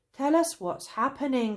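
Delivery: high, loud pleading cry. A narrator's reading. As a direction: call out desperate for answers. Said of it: "cried the travellers".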